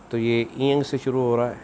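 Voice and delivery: ordinary delivery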